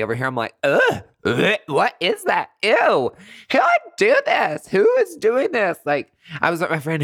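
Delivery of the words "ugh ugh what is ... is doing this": high, loud hammed-up disgust, slowing at the end; fake disgust. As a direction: pretend to be grossed out by the smell. Faking disgust to dodge blame.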